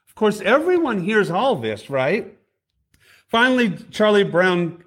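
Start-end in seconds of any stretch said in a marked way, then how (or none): none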